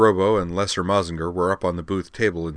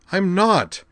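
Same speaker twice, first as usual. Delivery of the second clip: insisting; 13 semitones higher, 6 dB louder than usual. Acted. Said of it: "Liza insisted".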